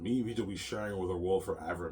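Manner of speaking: ordinary talk, nothing marked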